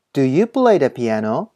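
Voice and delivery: slow "do you play the piano?" rising at the end; rising question. Shown as a demonstration. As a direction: lift the pitch on "piano" to ask. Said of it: "疑問文は最後を上げるように発音したり". (「do you play the piano?」の最後を上げて)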